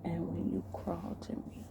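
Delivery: hushed, intimate delivery with long pauses; seductive role-play. Acted. Man giving his partner intimate orders, hushed seduction.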